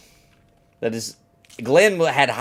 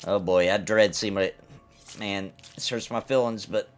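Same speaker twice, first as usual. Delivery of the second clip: normal talking voice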